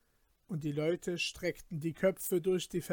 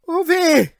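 high, loud yelp of pain; pained cry; 12 semitones higher, 17 dB louder than usual. Acted. Peter burns his hand on the glowing glass, crying out. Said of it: "schrie er".

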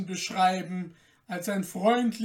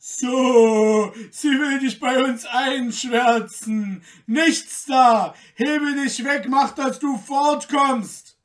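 loud, higher hostile dismissal; angry shout; 5 semitones higher, 9 dB louder, slower than usual. A narrator's reading. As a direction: drive her off with shouting. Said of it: "riefen die Wächter".

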